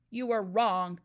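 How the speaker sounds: loud, curt bark. A narrator's reading. Trunchbull barks at the nervous boy, loud and curt. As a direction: bark it straight back. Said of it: "the Trunchbull barked".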